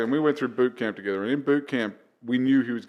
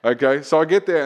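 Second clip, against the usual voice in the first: normal talking voice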